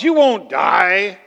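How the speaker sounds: emphatic, slow sarcasm; sarcastic. Acted. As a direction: drag it out with mocking emphasis. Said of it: "Don't you sense the sarcasm?".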